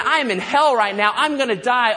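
urgent dramatized cry from a doomed man; Jonah. Acted. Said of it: "He's saying".

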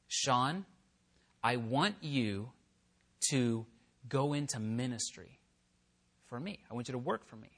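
low-key whispered-feeling summons; quiet voice of God. Retold or imitated. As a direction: speak it like a hushed inner call. Voicing God's call.

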